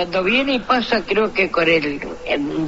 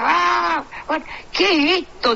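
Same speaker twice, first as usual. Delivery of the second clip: put-on high, panicked cry of a startled kid; imitating a frightened kid's cry; mock panicked yell; 7 semitones higher, slower than usual. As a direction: yelp it like a scared boy. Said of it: "un pibe que gritaba".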